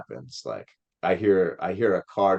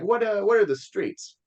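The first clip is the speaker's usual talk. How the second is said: much higher, questioning imitation; probing driver; 13 semitones higher than usual. Dad asking which streets, high imitated voice. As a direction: press the kid with a mock-curious query.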